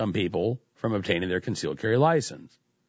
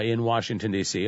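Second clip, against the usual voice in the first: ordinary talk, nothing marked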